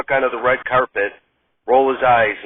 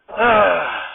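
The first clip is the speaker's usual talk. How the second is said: fed-up sigh; 5 semitones higher than usual. Acted. Radner fed up.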